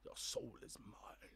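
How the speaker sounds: whispered slow threat; whispering. Retold or imitated. Quoting villain again.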